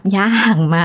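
puzzled echo. Retold or imitated. Doctor acting out her reaction to a patient's odd herb question, puzzled echo. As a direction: repeat "หญ้าหางม้า" as if hearing a strange new remedy. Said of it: "หมอยังแบบ".

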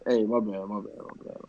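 dropping to a whisper; quieting down. Real, not acted. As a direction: pull the voice right back. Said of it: "Am I yelling?".

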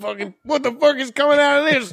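high, incredulous guitarist; mock guitarist outrage. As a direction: pull a disgusted guitar face voice. Mimicking guitarist's stink eye.

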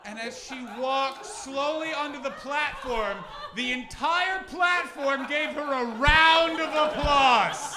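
high, loud, slowing comic climax; building triumphant finish. Real, not acted. Comic finishing the story to the crowd, loud slow triumphant build. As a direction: swell it up over the laughter.

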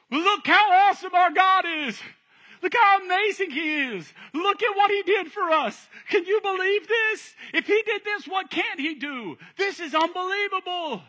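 high, loud, thrilled exclaiming; awestruck excitement. Acted. Believer walking through the sea, thrilled and amazed. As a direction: marvel out loud at what God did. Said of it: "one person that walked through it like this".

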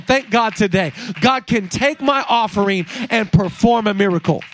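loud, rising preacher's call; preaching at full force. Acted. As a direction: preach it out with fire. Preaching climax.

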